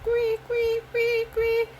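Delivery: piercing mock squeak, very high; high squeaky noises. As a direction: squeal a high quee over and over. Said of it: "Bruno Boy was like".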